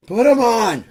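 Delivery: high, blasting angry command; mad wife shouting. Acted. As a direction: yell the order at a runaway husband. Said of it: "she's really mad at him".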